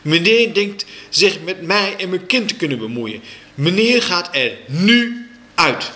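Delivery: high, emphatic dismissal; angry mother. A narrator's reading. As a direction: order him out angrily. Mother throws the teacher out. (boos en nadrukkelijk wegsturen)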